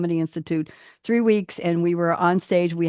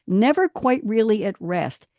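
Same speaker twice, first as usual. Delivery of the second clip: louder, emphatic poem recital; dramatic spoken-word delivery; poetry reading; 3 semitones higher, 4 dB louder than usual. Acted. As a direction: read each word with weight, like a performance. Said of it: "I'll try to emphasize the word".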